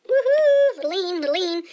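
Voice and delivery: high loud woohoo, then playful; thrilled gamer whoop; excited whoop. Real, not acted. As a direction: cheer it out. Cheering while leaning in game.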